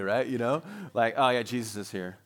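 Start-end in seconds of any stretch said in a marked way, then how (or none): 1.2-2.2 casual, low-pitched throwaway delivery; offhand sisterly mention. Acted. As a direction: drop the news in as if incidental. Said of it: "That's kind of a sisterly thing to do".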